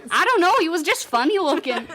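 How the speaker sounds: high, hammed-up Minnesota accent; loud-starting mock Minnesotan. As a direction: exaggerate the Minnesota sing-song. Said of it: "talk like that in Minnesota".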